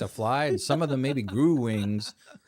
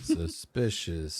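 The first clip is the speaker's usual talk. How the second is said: mock suspicious; 7 semitones lower, much slower than usual. Echoing in slow low voice.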